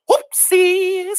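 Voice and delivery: high sheepish exclamation; high little whoops; embarrassed girl. A narrator's reading. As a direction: say it after a tumble. After falling.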